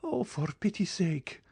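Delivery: breathless whispered begging; whispered plea. A narrator's reading. Mother begging the Count to spare her son, whispering. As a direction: whisper it, high and desperate.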